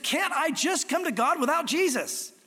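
imitated earnest appeal; retold plea. Retold plea of girl he witnessed to.

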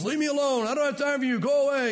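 a hammed-up cranky God shooing you away; grumpy ogre. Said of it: "God is some ogre".

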